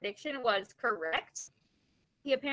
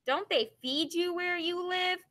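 higher, slow, mildly sneering jibe; 6 semitones higher than usual. A narrator's reading. Schoolboy Spider mocking John for eating gloves, light sneer. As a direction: draw it out as a mocking question. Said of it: "he sneered".

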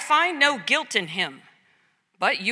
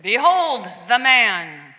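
loud, slow announcement; proclamation; 5 dB louder, slower than usual. Acted. Pilate shows Jesus to the crowd, loud slow proclamation. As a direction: present him with drawn-out force.

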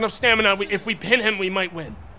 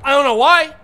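frustrated outburst; 5 semitones higher, 8 dB louder than usual. Real, not acted. Frustrated at the referee.

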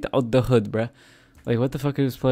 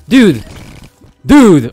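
loud, higher alarmed cry of dude; startled shout; 3 semitones higher, 11 dB louder than usual. Real, not acted. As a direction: shout it as something hits you. Startled by game event.

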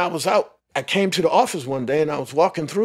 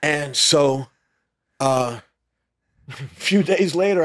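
ordinary talk

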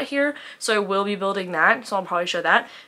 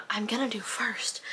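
whisper; 9 dB quieter than usual. Real, not acted. Confiding to viewers mid-sentence.

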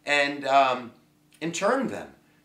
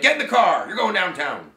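loud, high mock police order; barking cop; 3 semitones higher, 5 dB louder than usual. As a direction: order a suspect around like a cop. Acts out cop arresting someone.

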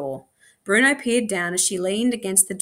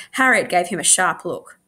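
usual speaking voice

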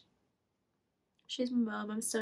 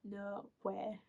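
softer echo of mum's "no way"; retold disbelief; 10 dB quieter than usual. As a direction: imitate mum's disbelief, softly and understated. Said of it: "In that tone".

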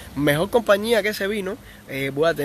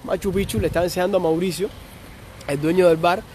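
normal talking voice

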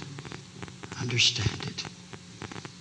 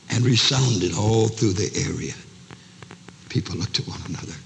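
ordinary talk, nothing marked

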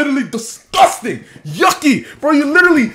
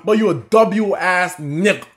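ordinary talk

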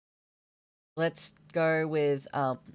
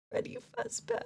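squeaky; 3 dB quieter than usual. Pretend. Squeaky voice for Freddy.

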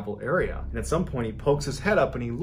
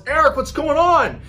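high, loud frightened wife; wife yelling; 9 dB louder than usual. Retold or imitated. Wife yelling down the stairs, high loud call. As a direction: yell down from upstairs, high and loud. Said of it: "and she yells".

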